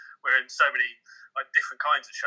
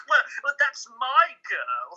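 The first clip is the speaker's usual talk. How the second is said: mock fatherly line; 11 semitones higher, much slower than usual. Acting out a role moment.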